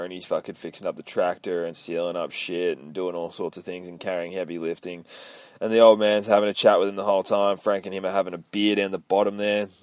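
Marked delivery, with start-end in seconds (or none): none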